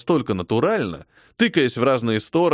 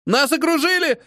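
panicked yell; 14 semitones higher than usual. A narrator's reading. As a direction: scream it in panic, much higher. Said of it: "закричал один из новобранцев". (новобранец в панике кричит)

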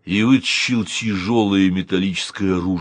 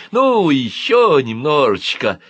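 slow, higher, wheedling plea; innocent coaxing; 6 semitones higher, 5 dB louder, slower than usual. A narrator's reading. Uncle presses one more spoonful, innocent coaxing. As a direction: stretch it out innocently. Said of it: "невинно сказал он".

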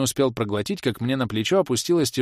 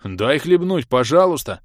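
pestering plea; 5 semitones higher, 7 dB louder than usual. A narrator's reading. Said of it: "Не отставала злоба от Кирей".

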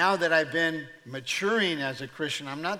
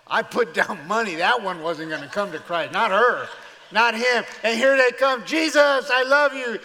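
loud, rising to a high shout; outraged bettor; 5 semitones higher, 11 dB louder than usual. Acted. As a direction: shout in mock disbelief at losing the bet. Angels lose bets on converts.

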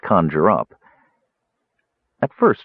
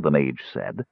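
ordinary delivery

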